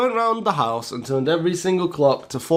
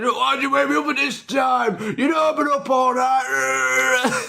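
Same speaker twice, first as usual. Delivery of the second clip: furious dad shout; 11 semitones higher, slower than usual. Retold or imitated. Dad yelling at his son over the changed clocks, furious high shout. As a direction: blast the son for waking him up.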